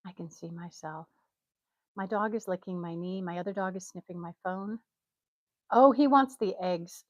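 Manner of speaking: ordinary delivery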